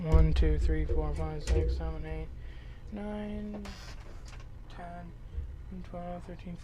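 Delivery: quiet muttered counting to himself; counting under breath. Real, not acted. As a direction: count softly, almost to yourself. Counting knives under his breath.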